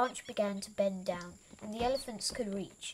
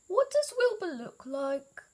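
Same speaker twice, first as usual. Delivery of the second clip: higher, louder questioning elephant; curious elephant; 10 semitones higher, 4 dB louder than usual. A narrator's reading. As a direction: ask eagerly, pitched up. Elephant asks curiously in story.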